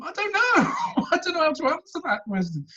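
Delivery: high, laughing disbelief. Real, not acted. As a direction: laugh it out, helpless. Laughing at the memory.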